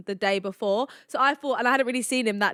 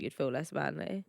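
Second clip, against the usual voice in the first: a low, quiet mock-macho repeat; mock manly voice; 7 semitones lower, 11 dB quieter than usual. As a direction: drop low and soft to mock him as a man. Mocking repeat of his claim.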